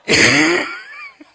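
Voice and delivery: big burst of real laughter; loud real laugh. Real, not acted. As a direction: let out a hearty laugh. Laughing at the story.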